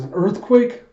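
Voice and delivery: retold shock, pitched right up; startled question. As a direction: ask in mock astonishment, ending up high. Another character notices the quake.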